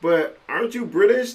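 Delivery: high, teasing mock question; family poking fun, high and teasing; mock teasing jab. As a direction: needle him like a smug relative. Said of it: "as a jab".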